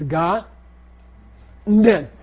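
GA, a pause, then DEN opened with a nasal N; N on second syllable. Shown as a demonstration. Teacher shows where N belongs, syllables split. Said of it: "The N belongs to the second syllable".

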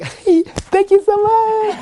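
high-pitched, drawn-out gushing thanks; happy lady. Acted. Teacher voicing the lady he took to nirvana, happy and squealing. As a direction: play an overjoyed lady, very high and slow. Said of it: "it's this happy lady".

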